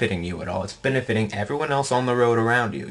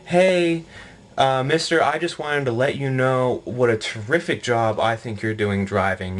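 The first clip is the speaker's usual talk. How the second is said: exaggerated fake admiration; syrupy, mock-sincere praise; sarcastic gushing. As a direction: lay on the phony gratitude, stretching key words. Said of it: "very sarcastically tell you off".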